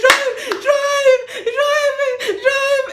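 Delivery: high, loud frantic begging, half laughing; frantic pleading. Retold or imitated. To the taxi driver, she begs him to drive in a high frantic voice. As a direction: urge the driver on in a panic.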